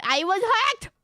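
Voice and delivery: high, loud, hammed-up protest; mock outcry. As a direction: blurt it out in mock desperation. Hammed-up excuse in role-play.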